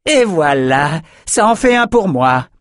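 high, triumphant crow; gleeful. A narrator's reading. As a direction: boast of a kill. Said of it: "elle éclata de rire".

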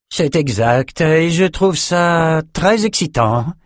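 feigned enthusiasm, stretched out and insincere; unconvinced. A narrator's reading. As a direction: say it slowly, meaning the opposite. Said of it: "d'un ton qui indiquait exactement le contraire".

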